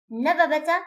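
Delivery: "Never better" with no r at the end of "Never". Shown as a demonstration. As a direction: leave the r out before "better". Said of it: "is pronounced never with no re".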